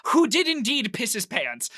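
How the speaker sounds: ordinary talk, nothing marked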